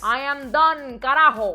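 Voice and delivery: punchy, exasperated and emphatic. Real, not acted. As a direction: declare you've had enough. Exasperated outburst at stereotypes.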